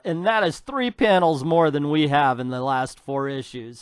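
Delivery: ordinary talk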